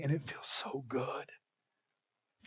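hushed unvoiced whisper. Real, not acted. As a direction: lean in and breathe it. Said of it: "whispering joy of obedience".

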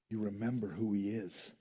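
very soft, low-pitched reminder; hushed answer. Real, not acted. Preacher answering what to do when prayer fails, hushed and low. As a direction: drop right down, almost to yourself.

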